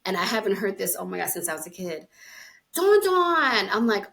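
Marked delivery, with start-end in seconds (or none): 2.7-3.7 put-on high, loud call in a New Jersey accent; imitated New Jersey "Dawn". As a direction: holler the name with New Jersey vowels. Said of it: "they say Dawn".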